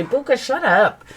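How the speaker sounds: high, quick telling-off; real scolding aside. Real, not acted. Storyteller interrupting herself to hush Buka, scolding. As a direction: break off to shush in a high voice.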